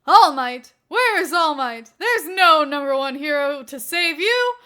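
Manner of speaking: loud, hammed-up villain laugh and boast; maniacal villain. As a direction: ham it up as a cartoon villain. Prankster in villain role.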